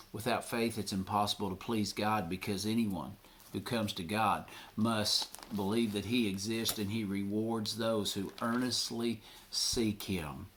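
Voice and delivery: usual speaking voice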